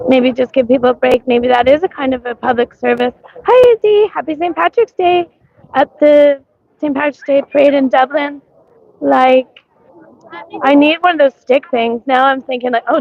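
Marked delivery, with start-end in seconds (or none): none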